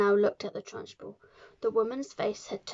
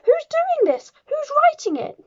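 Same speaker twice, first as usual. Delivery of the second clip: very high, loud shriek of a demand; yelling; 10 dB louder than usual. A narrator's reading. Trunchbull demands the invisible writer, yelling. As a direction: scream at an unseen culprit. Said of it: "she yelled at nobody in particular".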